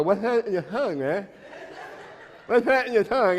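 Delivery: high, laughing mock taunt; teasing mockery. Dad teasing daughter's boyfriend about his tongue ring, laughing mock voice. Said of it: "I made fun of him".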